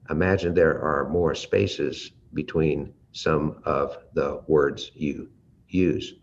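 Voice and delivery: slow, with spaces between the words; exaggerated slow. Shown as a demonstration. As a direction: stretch each gap on purpose. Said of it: "I'm exaggerating".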